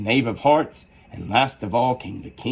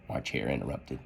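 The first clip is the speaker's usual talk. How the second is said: usual speaking voice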